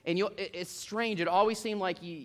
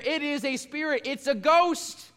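mock fear; 8 semitones higher, 4 dB louder, slower than usual. Disciples' frightened cry acted.

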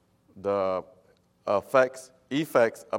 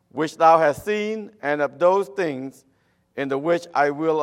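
loud, high scripture reading; preaching scripture; 5 semitones higher, 8 dB louder than usual. Acted. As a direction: proclaim the verses at full force. Reading scripture aloud forcefully.

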